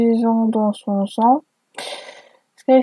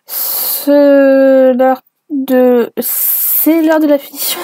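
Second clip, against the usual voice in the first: mock finishing-move cry; 3 semitones higher, 5 dB louder, slower than usual. Reader acting the fanfic's finishing move, slow and hammed-up. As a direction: drag it out like a villain's final blow.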